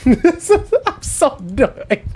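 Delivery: laughing, drawn out. Real, not acted.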